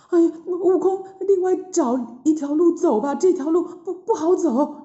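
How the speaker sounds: high, wavering plea, stumbling on words; frightened Tang Seng. A narrator's reading. Monk begs his disciple to find another road, frightened. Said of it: "唐僧看了就很害怕的说".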